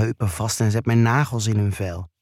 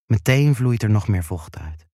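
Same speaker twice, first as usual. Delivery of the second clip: ordinary delivery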